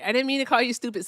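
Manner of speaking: fast, loud over-the-top apology; mock apology. Streamer fake-apologizes to the guest for calling him stupid. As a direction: gush a hammed-up sorry. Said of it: "I'm sorry".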